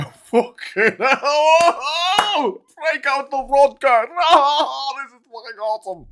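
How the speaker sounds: high, loud, drawn-out gleeful wailing; wild mock celebration. Breaking out the vodka, hammed-up loud glee. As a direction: whoop it up in hammed-up ecstasy.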